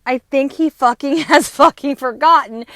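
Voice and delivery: loud, laughing outburst; laughing exasperation. Real, not acted. Venting about her forgetful dad, laughing loudly.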